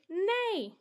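high falling neigh; whinny like a horse. Shown as a demonstration. Reading animal sounds aloud.

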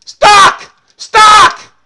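very loud, high-pitched yells; shouted outburst. Real, not acted. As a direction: explode in two separate shouts. Sudden outburst while playing the game.

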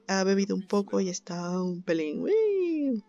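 ordinary talk

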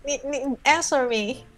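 normal talking voice

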